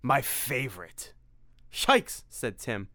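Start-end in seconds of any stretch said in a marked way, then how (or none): 0.0-1.1 vampire voice. Acted. Dracula in Simon's body savoring. 1.7-2.2 high startled yelp; sudden high yikes. Acted. Tim startled by vampire.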